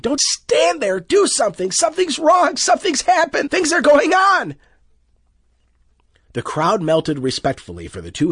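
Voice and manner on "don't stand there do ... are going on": high, loud desperate shouts; frantic shouting. A narrator's reading. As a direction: yell to be heard over traffic. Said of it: "Loyce shouted at them frantically".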